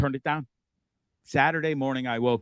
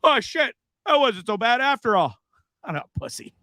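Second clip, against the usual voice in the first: imitating friend; 10 semitones higher than usual. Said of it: "he's like".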